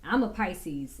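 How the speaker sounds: ordinary delivery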